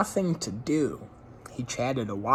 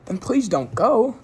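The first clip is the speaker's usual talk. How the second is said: high, loud "please don't go"; pleading hostess; 11 semitones higher, 7 dB louder than usual. A narrator's reading. Hostess urging Jones not to leave, high and pleading. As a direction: beg him sweetly to stay. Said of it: "the woman said".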